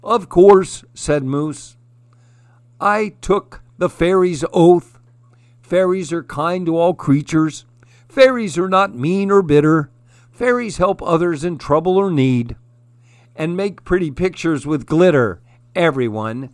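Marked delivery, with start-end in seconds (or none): 0.0-0.8 proud. A narrator's reading. Moose answering proudly. 2.8-15.4 proud recitation. A narrator's reading. Moose reciting oath proudly.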